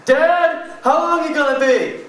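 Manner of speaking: strained urgent shout through the door; desperate call. Retold or imitated. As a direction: yell up at dad in a panic. Desperate call to dad in shower.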